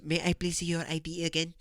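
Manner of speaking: mock-polite checkout request; put-on clerk voice; imitating store clerk. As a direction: lightly mimic an over-polite clerk. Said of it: "how I just said it is how she fucking said it".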